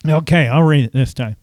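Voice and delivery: ordinary talk, nothing marked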